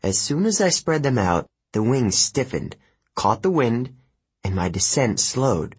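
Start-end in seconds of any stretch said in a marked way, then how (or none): none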